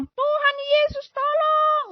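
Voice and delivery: slow, very high cry to Jesus; high desperate plea. Retold or imitated. Patient praying aloud for help, high and pleading. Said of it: "saya bilang gitu".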